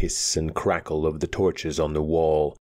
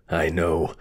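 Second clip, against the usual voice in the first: usual speaking voice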